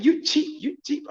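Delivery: high-pitched mimicked accusation; needling, squeaky-high put-down; mock insult. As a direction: imitate an offended woman haggling. Acts woman calling him cheap.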